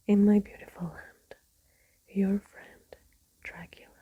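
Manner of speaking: whispered storytelling, every word unvoiced; ASMR whisper. Real, not acted. Whispering Dracula aloud to listeners winding down, ASMR whisper. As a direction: read the book in a steady ASMR whisper.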